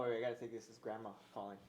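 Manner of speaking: normal talking voice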